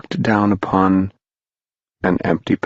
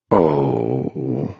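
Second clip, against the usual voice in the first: mournful oh; 6 semitones lower than usual. Acted. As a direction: grieve for the lost boy. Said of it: "mourned Mister Mound Shroud".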